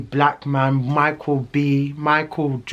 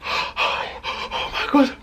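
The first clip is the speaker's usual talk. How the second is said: panicked whisper; 12 semitones higher, much slower than usual. Retold or imitated. Panicked in bathroom.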